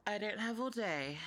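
snooty brat, demanding and teasing; bitchy bratty voice. Acted. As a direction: sneer every order like a spoiled brat. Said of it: "I was doing a bitchy, bratty voice".

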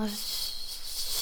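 ordinary delivery

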